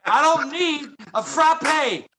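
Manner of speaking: put-on French accent. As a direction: ham up a snooty French café customer. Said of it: "French accent".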